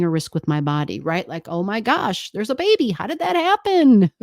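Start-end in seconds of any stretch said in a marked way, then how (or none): 1.5-4.1 rising higher into a shocked "How did that happen?"; playfully shocked at a surprise baby; mock surprise. Acting out a surprised reaction.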